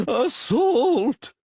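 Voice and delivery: high, loud outraged yell. A narrator's reading. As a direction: cry out from the floor. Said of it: "yelled the skinny individual".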